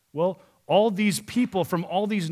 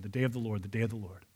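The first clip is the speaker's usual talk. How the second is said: each repeat softer and lower; fading echo; 7 semitones lower, 9 dB quieter, faster than usual. Acted. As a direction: let the phrase die away like an echo. Echoing repetition, dying away.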